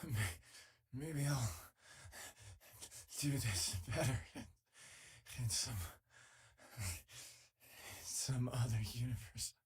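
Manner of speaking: soft, broken-off words fading into a whisper; halting whisper. Acted. Wistful crew member trailing off to a whisper about another universe.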